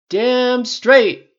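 loud, high shout of agreement; emphatic cry. A narrator's reading. Tony cheering on Pepper's rant, emphatic cry. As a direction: back a friend up at full volume. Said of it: "Tony cried".

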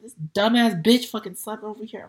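loud, furious outburst at the sister; angry yell. Retold or imitated. Retelling yelling at her sister in the room, loud and furious. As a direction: yell it like you're fed up with her. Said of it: "I said".

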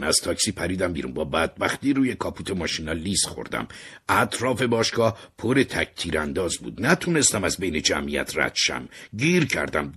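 ordinary delivery

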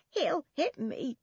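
put-on high, slow tearful old woman; weeping. A narrator's reading. Old woman afraid of her son, weeping. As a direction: wail it through tears. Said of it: "she wept".

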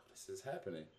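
retold thought, low and very soft; hushed realisation. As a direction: drop to a hushed, low voice as it sinks in. Retold dawning realisation it's real.

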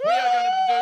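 squeak. Acted. Sudden squeaky outburst mid-intro.

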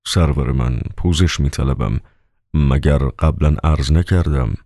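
plain reading voice

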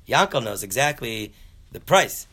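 acted-out scolding, punching the last word; wife's indignant protest. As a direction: argue it like an exasperated wife. Wife scolds husband about Yankel.

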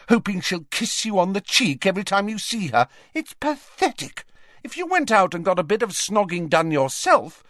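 high-pitched scornful rant; beside herself. Acted. Ginny berating her brother about Flem, scornfully angry. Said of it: "beside herself".

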